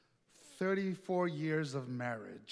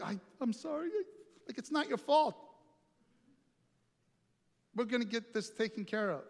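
put-on higher, flustered old lady; elderly lady voice; 5 semitones higher than usual. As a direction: play the rattled little old lady.

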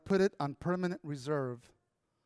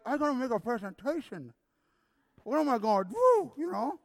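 higher-pitched, muffled fretting through a puffy lip; swollen-lip speech; 6 semitones higher than usual. Retold or imitated. Speaker replays his panic over the presentation, swollen-lip speech. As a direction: worry aloud with a lip too swollen to form words. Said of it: "this is the way I sounded".

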